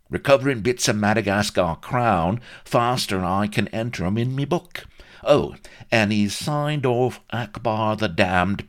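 high, emphatic Cockney character voice; cheeky Cockney agent. A narrator's reading. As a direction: testify for your client with Cockney bluster. Vladimir defends his client.

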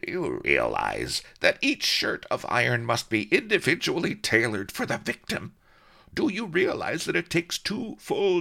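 high, whining rant of an overworked man; griping executioner. A narrator's reading. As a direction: complain loudly that nobody values your craft. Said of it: "he snarled".

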